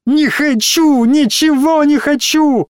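high desperate cry of refusal; shout. A narrator's reading. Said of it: "крикнула".

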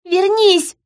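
put-on high child's shout; screamed plea. A narrator's reading. Daughter screaming for her father to return, high shout. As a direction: shout it high, begging someone back. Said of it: "закричала Ири".

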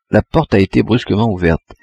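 ordinary delivery

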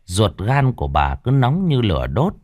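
ordinary delivery, nothing marked

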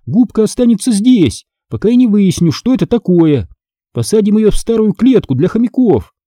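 high-pitched, firm instruction; decisive. A narrator's reading. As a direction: lay down the decision firmly. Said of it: "решительно заявила я".

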